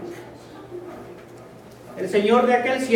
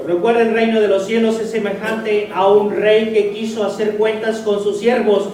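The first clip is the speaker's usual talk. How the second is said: loud, emphatic preaching read; forceful scripture reading; 6 dB louder than usual. Acted. Preacher reading the parable to his congregation, loud and forceful.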